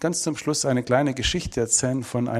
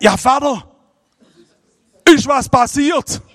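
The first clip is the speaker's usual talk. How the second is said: alarmed son; 13 semitones higher, 8 dB louder, slower than usual. Retold or imitated. Son answers late call, alarmed.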